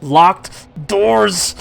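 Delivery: panicked man behind the door; through gritted teeth. Acted. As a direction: grind it out through clenched teeth. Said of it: "behind gritted teeth".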